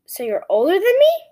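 high loud surprised question; disbelieving rising question. A narrator's reading. Kate surprised.

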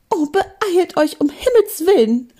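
high, alarmed cry; urgent call. Acted. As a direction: urge them on in a high voice. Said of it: "rief Mathilda".

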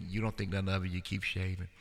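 ordinary talk, nothing marked

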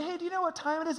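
high, falsely innocent call to wife; pointed question. Retold or imitated. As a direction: ask it as a veiled nudge. Acting pointed question to his wife.

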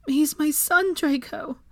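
high, thin plea; feeble, beseeching; weak murmur. A narrator's reading. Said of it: "Molly murmured weakly".

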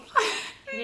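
high squealing laugh. Real, not acted. Laughing at own in-joke.

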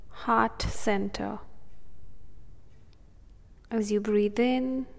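soothing, unhurried breathing cues; calm meditative tone. Acted. Meditation continues.

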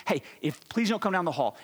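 ordinary delivery

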